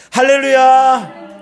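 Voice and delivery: high, loud, drawn-out praise shout; shouted hallelujah. Shown as a demonstration. Preacher leading congregation in a shout, full-voiced cry. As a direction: shout it long for everyone to join. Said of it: "소리 한번 질러볼까요".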